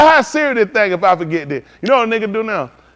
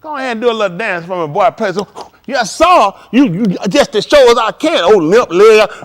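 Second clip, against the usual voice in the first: loud, jeering master's voice ordering a dance; 4 semitones higher, 7 dB louder than usual. Retold or imitated. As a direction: bark it like a mocking boss making him dance.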